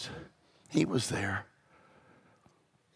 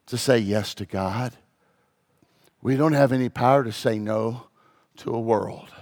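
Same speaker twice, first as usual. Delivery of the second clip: ordinary delivery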